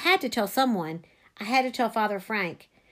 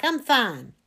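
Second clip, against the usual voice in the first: mildly raised call back; 3 dB louder than usual. A narrator's reading. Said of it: "I called back".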